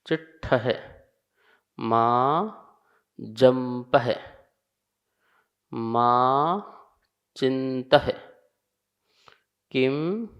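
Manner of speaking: each word said alone, with long pauses; word-by-word Prakrit verse recitation; slow word-by-word recitation. Shown as a demonstration. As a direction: recite slowly, one word at a time. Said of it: "उच्चारण करेंगे".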